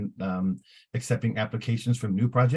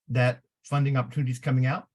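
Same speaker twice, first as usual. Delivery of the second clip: ordinary talk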